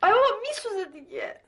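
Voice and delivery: high, loud yelp of protest; loud, high-pitched complaint of pain; pained cry. Acted. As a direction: cry out as the burn stings. Burn being treated, it stings.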